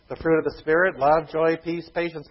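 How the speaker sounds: usual speaking voice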